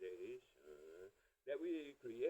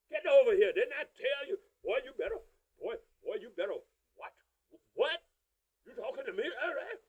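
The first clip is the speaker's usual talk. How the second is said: loud, higher threatening parent yell; scolding a child; 10 semitones higher, 12 dB louder than usual. Retold or imitated. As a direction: tear into the kid. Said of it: "That's how we talk to our own children".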